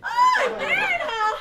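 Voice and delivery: high, loud shocked cry; shocked shriek. Acted. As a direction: gasp it out in shock. Shocked hostess.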